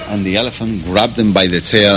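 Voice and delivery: ordinary talk, nothing marked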